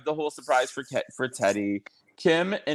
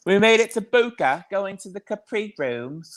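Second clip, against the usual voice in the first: higher, breathy-free mock of Dorit bragging; hammed-up Dorit impression, higher; Dorit's voice; 7 semitones higher than usual. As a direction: lift the pitch and do Dorit proudly. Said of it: "she talked in Dorit's voice".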